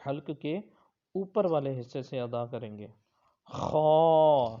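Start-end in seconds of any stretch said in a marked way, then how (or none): 3.5-4.6 خا with a scratchy throat rasp; harsh throat kha. Shown as a demonstration. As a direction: say خا from the upper throat with friction, loud. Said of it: "یعنی اس میں خراش سی ہو". (خا حلق سے خراش کے ساتھ)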